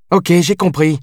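high, impatient interruption; cutting him off. A narrator's reading. Captain cutting off her lieutenant's excuse, interrupting. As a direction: cut the excuse short. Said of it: "le coupa la jeune femme".

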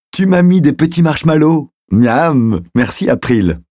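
put-on little girl thanking April for marshmallows; delighted little girl. A narrator's reading. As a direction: gush over the treat like a small kid. Child thrilled by marshmallows.

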